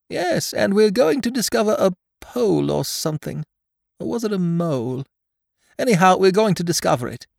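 high-pitched storybook bear, unsure but keen; high bear voice, puzzling it out; Pooh, muddled eager bear. Acted.

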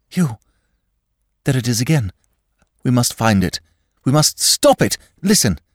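from fear to frantic pleading; rising panic. A narrator's reading. Shell-shock episode, mounting terror.

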